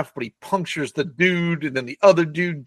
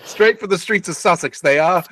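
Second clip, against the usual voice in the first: a jokey English accent, lightly put on; mock English accent; 3 semitones higher than usual. After the accent is mocked, goes on in mock English accent. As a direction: lands the line like a hammy Englishman. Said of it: "your English accent is nasty work".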